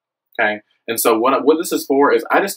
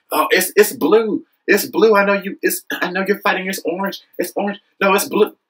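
pitched high, insisting back and forth; higher, exasperated mock squabble; mock heated protester; 8 semitones higher than usual. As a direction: play both sides of a silly argument, higher. Acting out a protest shouting match.

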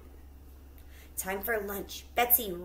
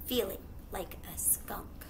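whispered narration; 10 dB quieter than usual. A narrator's reading. Sneaking wolf, whispered.

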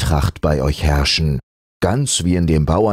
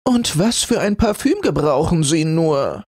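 high voice as if holding her nose; a flowery scrap wrinkling her nose; high disgusted cry; 13 semitones higher than usual. Acted. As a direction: scraps complaining about his smell.

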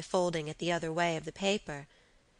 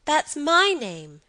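put-on high, loud, drawn-out child's voice; little boy; 8 semitones higher, 7 dB louder, slower than usual. A narrator's reading. Boy hears his own name, piping childish voice. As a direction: pipe up like a small boy.

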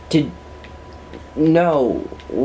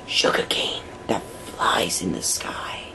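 hushed whisper fading lower; mock-reverent whispered praise; 9 semitones lower, 8 dB quieter than usual. Reverent address to sugarcane.

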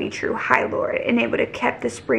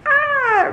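high, drawn-out fangirl "ah"; excited squeal. Real, not acted. Reader reacts to her favourite character's rescue, high excited squeal. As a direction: let out a high swooning cry.

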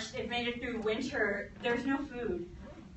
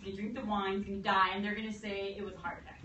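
ordinary talk, nothing marked

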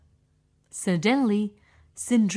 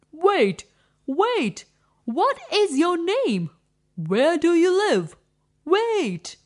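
much higher, louder calls after her; prince calling out; 6 semitones higher, 3 dB louder than usual. Acted. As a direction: shout after the girl running away.

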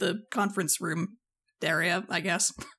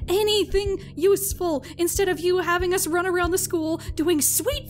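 higher, pressing harder with each line; angry rant; 7 semitones higher than usual. Acted. Fed-up member scolding the captain for wasted practice time, angrily. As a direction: scold her for wasting everyone's time. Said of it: "She clenches a fist and says".

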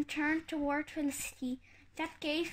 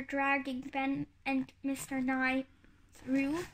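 usual speaking voice

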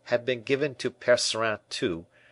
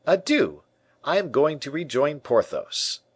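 raised, louder farewell; aloud; 7 semitones higher, 4 dB louder than usual. A narrator's reading. D'Artagnan leaves to rejoin Porthos, aloud. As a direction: announce it in a higher, carrying voice. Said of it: "he added aloud".

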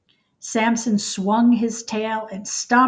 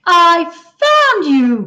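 slow, louder, higher joyful cry; 7 semitones higher, 10 dB louder, slower than usual. Acted. Samson finds the little red bird, slow joyful cry. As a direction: let the relief ring out as he finds her. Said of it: "cried Samson".

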